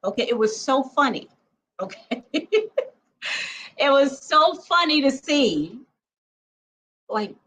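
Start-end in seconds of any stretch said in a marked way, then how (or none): 2.3-2.9 short high laugh breaking out; real laugh. Real, not acted. A woman laughing at her own panic in the pool, real giggle. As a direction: laugh at the memory of herself.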